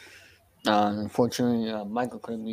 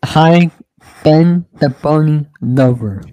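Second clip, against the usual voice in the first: much louder, drawn-out greeting; loud repeat; 4 semitones higher, 15 dB louder, slower than usual. Real, not acted. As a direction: call it out again, slow and loud.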